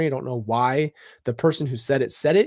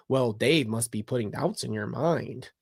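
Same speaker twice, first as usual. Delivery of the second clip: soft, understated imitation of a manipulator; Chad's manipulative voice; 3 semitones lower, 7 dB quieter than usual. Man working on a follower's doubts, imitated in a soft manipulative murmur. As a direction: imitate him quietly planting a suspicion. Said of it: "he hits her with a manipulative".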